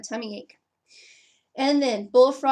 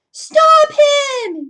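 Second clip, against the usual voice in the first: very high loud shout; yell; 14 semitones higher, 12 dB louder than usual. Acted. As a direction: holler it down the street. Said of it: "yelled the baker".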